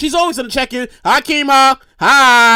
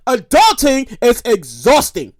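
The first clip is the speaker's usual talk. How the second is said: slow, low declaration; lowered, drawn-out complaint; weary pronouncement; 4 semitones lower, slower than usual. Real, not acted. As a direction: draw it out like a hard-won truth. Solemn pronouncement of his complaint.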